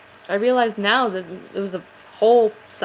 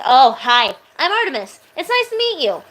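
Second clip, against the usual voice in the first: friendly Artemis voice; 3 semitones higher, 3 dB louder than usual. Shown as a demonstration. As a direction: greet a new friend brightly and sweetly. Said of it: "It's like a friendly voice".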